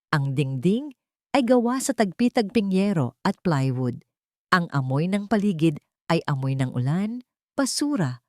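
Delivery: ordinary delivery, nothing marked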